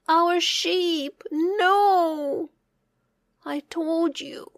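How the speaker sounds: high anguished cry; a villager grieving the sheep; dismayed cry. A narrator's reading. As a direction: cry out over the lost sheep. Villagers find dead sheep.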